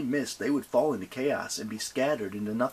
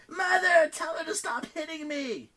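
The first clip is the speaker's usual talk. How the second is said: tattle to mother; whiny child. Acted.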